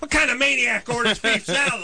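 high-pitched indignant rant; comic exasperated outrage; mock mad. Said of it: "you get mad at the person".

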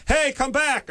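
high-pitched mock call after a thief; acted victim's cry; calling after someone. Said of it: "and then you go".

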